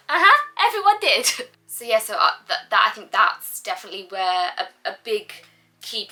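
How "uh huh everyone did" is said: high, louder sarcastic uh-huh; chirpy ironic yes; overly cheerful sarcastic agreement; mock bright agreement. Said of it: "And I was like".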